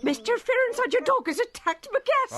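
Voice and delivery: high, outraged rebuke; indignant scolding. Acted. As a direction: scold him for the dog. Landlady scolds over dog attack.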